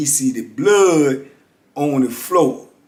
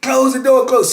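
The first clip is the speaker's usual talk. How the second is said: high, loud urgent command; yelling; 11 semitones higher, 5 dB louder than usual. Retold or imitated. Inmate ordering the guard to shut the door, loud yell. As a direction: bark the order at the booth.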